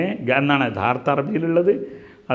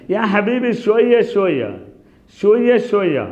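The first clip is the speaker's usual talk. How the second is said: mock call to a hurrying friend, higher; 6 semitones higher than usual. Shown as a demonstration. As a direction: wave a friend down, easy there. Teacher acts telling someone slow down.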